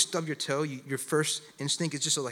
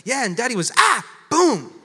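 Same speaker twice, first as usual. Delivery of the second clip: high, loud child imitating Dad's yell; tattling little boy; 7 semitones higher, 9 dB louder than usual. Four-year-old son telling mom what Daddy did, loud imitation. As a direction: blurt it out like an excited kid, exploding at the end.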